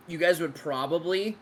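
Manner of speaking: speaking through hiccups; hiccuping. Real, not acted. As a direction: hiccups breaking the words. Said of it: "sorry, I'm hiccuping really bad".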